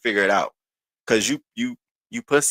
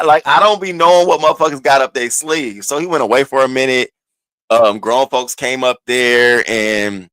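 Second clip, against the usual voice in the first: normal talking voice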